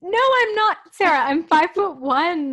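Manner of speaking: loud, high-pitched protest, stretched at the end; real indignant scream. Real, not acted. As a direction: scream back an indignant correction. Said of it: "you don't have to scream".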